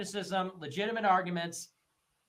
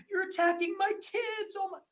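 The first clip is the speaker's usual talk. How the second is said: much higher mock outrage; mocking single mom; 12 semitones higher than usual. Streamer mocking single mom accusing critics of attacking her kids, high squealing mock voice. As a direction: squeal it like an offended mother. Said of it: "And then they go".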